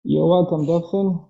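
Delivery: normal talking voice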